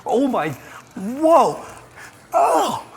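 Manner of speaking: high, loud shocked exclamations; real startled outburst; shocked yelp. Real, not acted. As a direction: cry out as the heat hits. First taste of very hot sauce.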